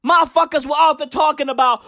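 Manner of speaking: ordinary talk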